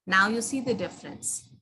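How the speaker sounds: usual speaking voice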